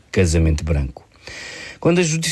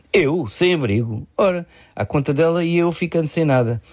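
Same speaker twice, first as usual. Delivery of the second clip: higher, incredulous echo of the accusation; mildly indignant disbelief; 8 semitones higher than usual. A narrator's reading. Accused man objecting to being called homeless, mildly indignant. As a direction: protest with a touch of indignation.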